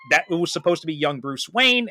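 normal talking voice

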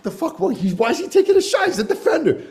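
high, incredulous squeal of disbelief; a stunned dad at the penalty spot; high-pitched what-the; shocked disbelief. Retold or imitated. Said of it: "and I'm like".

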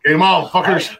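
loud, high battle cry; rally the table like a challenge; fired up. Retold or imitated. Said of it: "and it's like".

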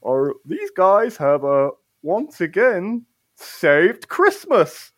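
slow, halting proclamation voice; Fuzzy Jumper. Acted. Game master's jumper announcing to the townsfolk, slow proclamation. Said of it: "Fuzzy jumper just goes".

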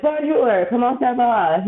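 put-on laughing through a clumsy Canadian-accented French greeting; bad Canadian French accent. Telling a friend how Parisians hear her, in a mock Canadian French accent. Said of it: "my horrible Canadian accent".

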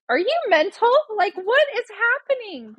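high-pitched, incredulous mom outburst; retold exasperated disbelief. Mom to injured son asking about video, acted high-pitched exasperation. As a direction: act out utter disbelief at your kid.

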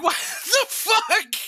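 very high, drawn-out mock outrage; retold shock, breaking into laughter; acted shocked disbelief. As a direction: laugh through a scandalised squeal. Retold shock at daughter's dirty joke.

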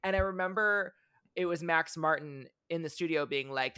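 usual speaking voice

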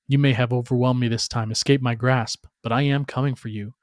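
ordinary delivery, nothing marked

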